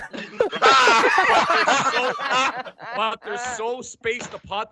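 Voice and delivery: slow, high, stretched-out words; drawn-out disgust. Acted. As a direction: stretch it out in disbelief. Mocking Batman's spaced teeth.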